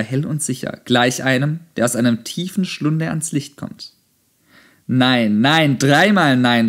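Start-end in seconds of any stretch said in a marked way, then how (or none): 4.9-6.7 loud, drawn-out emphatic refusal; strong voice. A narrator's reading. Zarathustra rejects the soothsayer's despair, strong voice. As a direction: call out each no with full force. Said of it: "rief er mit starker Stimme".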